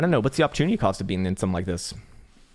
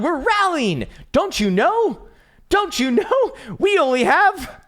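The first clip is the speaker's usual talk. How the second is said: high, loud cartoon cheer, slowed; squeaky mock victory chant; gleeful squeaky voice; 7 dB louder than usual. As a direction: crow triumphantly at Crown.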